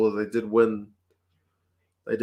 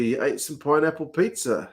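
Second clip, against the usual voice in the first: ordinary delivery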